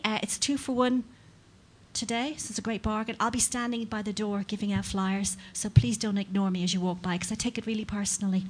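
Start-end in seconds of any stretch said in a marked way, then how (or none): none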